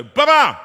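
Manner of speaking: high, loud "Ba-ba" mimicking a musical crash; orchestra crash. Shown as a demonstration. Comedian mimics the orchestra's crash, high and loud. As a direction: sing out "Ba-ba" like the orchestra hitting a chord. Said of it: "The orchestra go".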